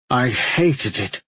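slow words through gritted teeth. Acted. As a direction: force it through clenched teeth. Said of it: "through his gritted teeth".

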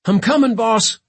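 high, loud answering call; calling back. A narrator's reading. London to first mate from the carcass, calling back. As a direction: shout back to the boss.

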